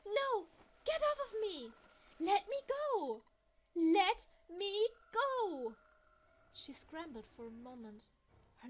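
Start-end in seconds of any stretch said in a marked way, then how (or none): 0.1-5.8 much higher and louder, slowing into drawn-out shrieks; screaming struggle. Acted. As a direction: fight him off, shrieking. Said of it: "pulling the screaming girl away".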